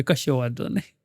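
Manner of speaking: low, soft, uncaring reply; indifferent wife. Retold or imitated. Wife answers her late husband, low and indifferent. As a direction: say it low and soft as if you no longer care. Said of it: "જવાબ એક જ હોય".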